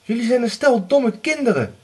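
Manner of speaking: high, loud scolding; mother scolding her daughters angrily; angry. Acted. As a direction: scold the foolish girls. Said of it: "zei de weduwe boos".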